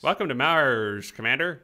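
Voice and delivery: slow, drawn-out mock Southern drawl; playful fake Southern accent on the game's welcome line. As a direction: lay on a hammy Southern accent. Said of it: "what voice was that? ... that's my southern accent".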